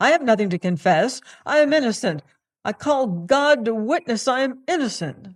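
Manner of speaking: loud, fast, high panicked pleading; frantic protest. Acted. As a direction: blurt it out in terror of being accused. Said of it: "he cried".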